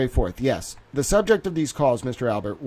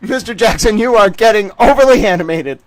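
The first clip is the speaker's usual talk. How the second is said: louder, hammed-up judge rebuke; mock stern judge; 6 semitones higher, 8 dB louder than usual. Judge reprimanding the lawyer, hammed-up stern. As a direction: scold him like an unruly pupil. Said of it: "She should have said".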